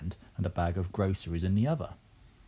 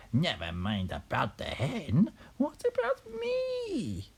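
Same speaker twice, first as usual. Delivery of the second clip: slow, screeching granny rising very high; rooftop shout; 8 semitones higher, slower than usual. A narrator's reading. Grandma demanding attention from the roof, high drawn-out screech. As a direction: drag it out, climbing to a squeal. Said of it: "Grandma shouted from the rooftop".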